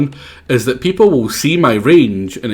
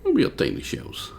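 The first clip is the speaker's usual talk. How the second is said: whisper; 12 semitones higher, 10 dB quieter than usual. Acted. Voicing a game character.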